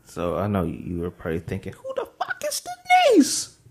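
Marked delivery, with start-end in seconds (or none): 1.7-3.6 much higher, louder, put-on voice; squeaky disbelief. Cohost voicing the audience's confusion, high squeal. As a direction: squeal it like you can't believe it.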